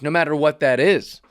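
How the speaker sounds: ordinary delivery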